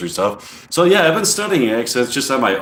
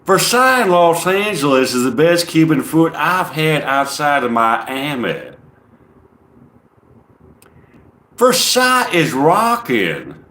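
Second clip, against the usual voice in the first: put-on funny Southern accent; 4 semitones higher than usual. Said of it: "it's a lot less boring if I do a funny accent".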